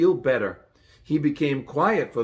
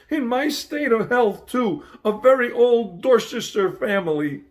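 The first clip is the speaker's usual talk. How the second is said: much higher, louder whining lament; wailing; 7 semitones higher, 3 dB louder than usual. A narrator's reading. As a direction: wail on in self-pity. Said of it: "it was wailing on".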